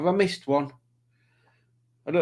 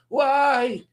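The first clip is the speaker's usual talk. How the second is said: much higher, slow, theatrical cry; mock anguish at the sun; 11 semitones higher, slower than usual. Shaking a fist at the sun, high slow theatrical wail. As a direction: wail up at the sky, hammed up. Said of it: "Like that".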